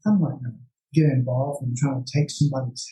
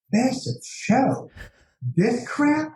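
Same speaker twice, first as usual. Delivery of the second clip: scornful; 10 semitones higher, much slower than usual. Retold or imitated.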